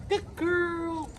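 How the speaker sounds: high squeaky dog praise, repeated. Acted. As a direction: keep the dog engaged with a bright squeak. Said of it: "I'm gonna use my squeaky voice".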